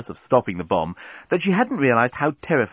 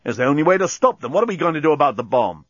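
fast, impatient, higher voice; short-tempered; 4 semitones higher, 3 dB louder, faster than usual. A narrator's reading. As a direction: rush it out impatiently. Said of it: "getting a bit short-tempered".

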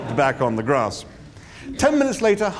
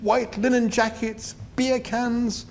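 dreamy delight; 9 semitones higher, much slower than usual. A narrator's reading. Arthur savouring English things.

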